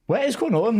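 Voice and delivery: high incredulous cry of disbelief. Retold or imitated. Pundit recalls a foul left unpunished, incredulous high cry. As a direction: squeak out your disbelief at the missed booking. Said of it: "And I'm like".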